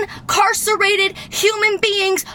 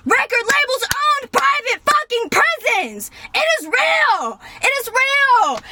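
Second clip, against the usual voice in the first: raised pitch, insisting hard; heated insistence; 6 semitones higher than usual. Real, not acted. Insisting to viewers that the pipeline is real, heated. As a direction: drive it home like it's undeniable. Ranting about prisons and labels.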